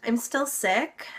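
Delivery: ordinary delivery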